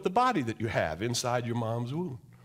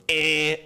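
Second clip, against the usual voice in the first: a loud, jarring eh sound; harsh dissonant eh; 2 semitones higher, 7 dB louder than usual. Shown as a demonstration. Preacher imitates a dissonant tone, loud jarring eh. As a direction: make an ugly clashing tone. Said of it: "a tone that goes".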